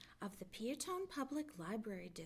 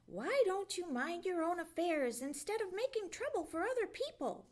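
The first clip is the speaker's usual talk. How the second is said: fast, higher, louder scolding; angry snarl; 7 semitones higher, 4 dB louder, faster than usual. A narrator's reading. Fox scolding the meddling jay, fast angry snarl. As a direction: rattle off an irritated rebuke. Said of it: "snarled Reddy Fox".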